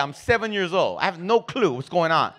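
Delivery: usual speaking voice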